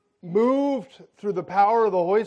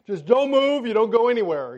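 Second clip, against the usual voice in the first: firm, higher-pitched order; louder, insistent scolding; bossy wife; 4 semitones higher, 4 dB louder than usual. Retold or imitated. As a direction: lay down the law like a wife. Wife orders him to stay.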